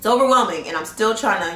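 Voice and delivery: usual speaking voice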